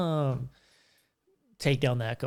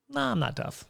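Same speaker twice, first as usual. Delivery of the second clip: higher-pitched, playful backing down; mock meek denial; 10 semitones higher, 4 dB quieter than usual. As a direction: lightly act out a harmless brush-off. Retold reply backing down from drunk challenger.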